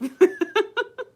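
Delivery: high rising laugh; a quick high giggle; real laugh. Real, not acted. Laughing mid-story to the viewer.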